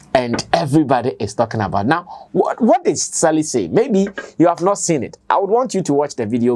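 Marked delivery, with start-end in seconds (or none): none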